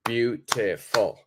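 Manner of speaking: "beautiful" broken into three slow, separate syllables; teacher slowly counting out the syllables of "beautiful", one at a time; counted out by syllable. Shown as a demonstration. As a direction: say "beautiful" as beau, ti, ful with a gap after each beat. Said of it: "if we count out beautiful".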